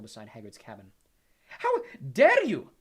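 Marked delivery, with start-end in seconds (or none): 1.5-2.7 slow, high, very loud shout; outraged shout. A narrator's reading. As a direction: shout it drawn out across the grounds. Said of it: "the figure shouted as she ran".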